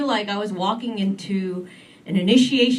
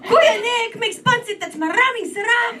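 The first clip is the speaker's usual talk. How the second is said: much higher, chatty auntie-style voice; gossipy Filipino interviewer; 12 semitones higher than usual. Retold or imitated. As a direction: rattle off the dishes like a Tita planning a potluck. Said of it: "He's like".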